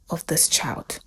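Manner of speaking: fading hushed whisper; doctor whispering the verdict to David. Acted. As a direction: drop to a whisper at the end. Doctor delivering bad news.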